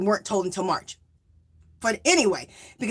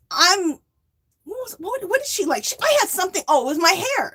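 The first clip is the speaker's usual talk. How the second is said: normal talking voice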